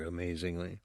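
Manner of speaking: usual speaking voice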